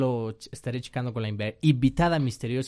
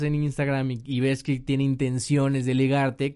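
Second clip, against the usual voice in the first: ordinary talk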